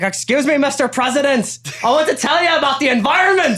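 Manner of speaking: preachy rock star scolding the President; [name] impression. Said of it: "[name]'s imitation of [name] scolding the crowd".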